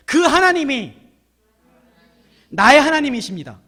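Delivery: loud proclamation with room to echo; call to repeat. Shown as a demonstration. Preacher leading the second phrase for repeating, loud proclamation. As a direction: declare it big for the congregation. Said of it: "따라해봅시다".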